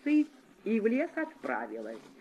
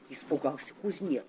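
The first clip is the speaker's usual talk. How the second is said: usual speaking voice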